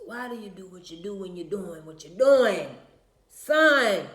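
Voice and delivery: loud granddaddy scolding, building to a shout; old granddaddy hollering at his boy. Acted. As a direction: scold like a granddad, booming out the last words. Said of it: "In my granddaddy voice".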